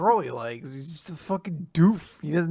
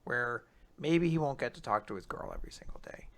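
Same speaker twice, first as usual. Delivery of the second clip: whispered sympathy; 5 semitones lower, 10 dB quieter than usual. Real, not acted. Sad about the boy.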